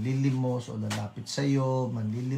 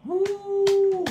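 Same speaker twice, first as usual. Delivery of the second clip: a high, loud joyful whoop; excited cheer; 7 dB louder than usual. Real, not acted. Pastor cheering the donated boats for the Bajau, loud high whoop. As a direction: cheer like the news is the best thing.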